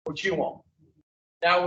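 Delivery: usual speaking voice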